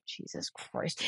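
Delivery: breathy mutter under the breath; exasperated whisper. Retold or imitated. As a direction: sigh it out, fed up. Boyfriend's exasperated looks voiced.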